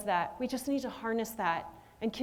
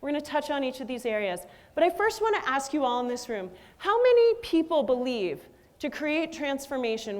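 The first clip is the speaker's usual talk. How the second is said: ordinary talk, nothing marked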